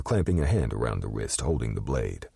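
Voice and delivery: plain reading voice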